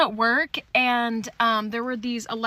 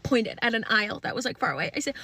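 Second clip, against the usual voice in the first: ordinary delivery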